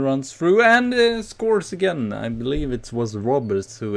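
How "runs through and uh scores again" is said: building higher and louder to the goal; rising thrill as he scores again; excited. Real, not acted. Gamer calling the second goal live, rising excitement.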